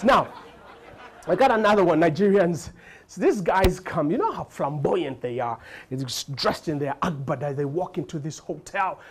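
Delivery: normal talking voice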